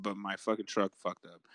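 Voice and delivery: ordinary talk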